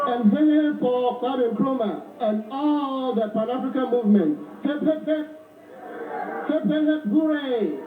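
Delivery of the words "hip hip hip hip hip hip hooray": high chant ending in hooray; rallying cheer. Real, not acted. Speaker leading crowd cheers for Pan-African movement, rallying chant. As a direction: lead three cheers, pitched up.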